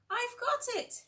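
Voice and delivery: high, eager little mouse voice; mouse's eureka. A narrator's reading. Mouse alone at night hits on an idea, high and eager. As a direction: squeak it out like a sudden bright idea. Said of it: "he said".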